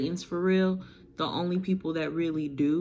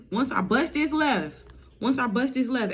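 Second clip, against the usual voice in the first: excited high voice; 8 semitones higher, 3 dB louder than usual. Acted. Eren hyped repeating.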